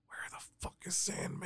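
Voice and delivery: urgent whisper. Retold or imitated. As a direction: hiss it so the crowd can't hear. Wrestlers whispering in the ring.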